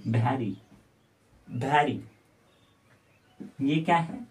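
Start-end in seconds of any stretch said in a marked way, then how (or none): 0.1-2.0 isolated "भैरी", BH in place of V; regional mispronunciation. Retold or imitated. Teacher repeating what UP-Bihar teachers say, "भैरी" with BH for V. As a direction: say "भैरी" for very, opening on an aspirated B. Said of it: "इसे भैरी बोलते हैं".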